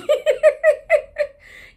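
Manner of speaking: very high, loud laugh; high burst of laughter. Real, not acted. Mother laughing about her chaotic day, loud laugh. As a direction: let the laugh fly.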